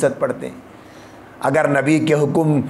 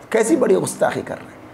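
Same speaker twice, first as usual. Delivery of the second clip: fast indignant repeat; 3 semitones higher, faster than usual. Acted. Preacher repeats the outrage.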